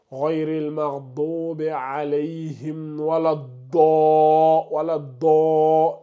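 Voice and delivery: slow imitation of reciters saying "wala do" with a plain d; imitating reciters' mistake. Teacher imitates careless reciters for students, slow mock recitation. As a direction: drawl "wala do" as certain reciters wrongly say it. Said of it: "ils vont dire".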